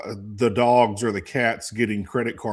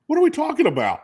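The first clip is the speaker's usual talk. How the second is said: much higher, incredulous what; baffled disbelief. Retold or imitated. Dazed player answering the coach, high and baffled. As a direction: sound totally lost and confused. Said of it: "I was like".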